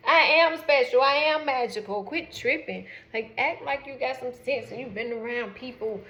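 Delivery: high, loud proud retort; sassy loud comeback. Retold or imitated. Daughter snaps back at gushing mom, loud sassy pride.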